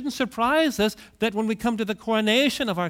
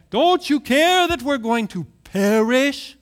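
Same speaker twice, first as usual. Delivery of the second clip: high, loud frantic plea; panicked cry; 6 semitones higher, 9 dB louder than usual. Acted. Disciples wake Jesus in the storm, panicked loud cry. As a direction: cry out in terror as the boat sinks.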